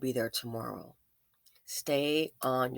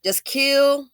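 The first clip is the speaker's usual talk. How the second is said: exclaimed; 7 semitones higher, 10 dB louder than usual. Real, not acted. Vlog sign-off exclamation.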